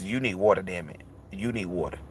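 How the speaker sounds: the body demanding water, low and quiet; insistent body voice. Retold or imitated. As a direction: press it home, low and quiet.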